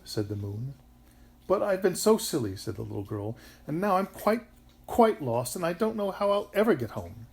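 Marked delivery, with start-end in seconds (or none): 1.5-2.6 put-on high, loud child's lament; upset little girl. A narrator's reading. Miserable little girl confides in moon. 3.7-7.3 put-on high, loud child's lament; upset little girl. A narrator's reading.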